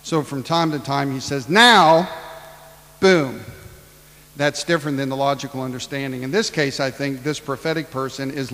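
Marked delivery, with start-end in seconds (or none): 1.5-3.4 loud sudden "Now" like a thunderclap; emphatic shout. Acted. Preacher voicing God, sudden loud cry. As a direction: hit "Now" hard and loud.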